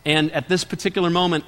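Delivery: ordinary talk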